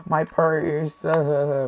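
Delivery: high, whimpering imitation sob; mock wailing. Imitating the woman crying over her purse, mock wail. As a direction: wail it, then trail into fake sobs. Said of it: "She was screaming, like".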